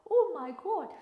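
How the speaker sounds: high, loud shocked squeal; shocked "oh my God". Retold or imitated. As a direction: shriek at the mirror. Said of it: "You look and say".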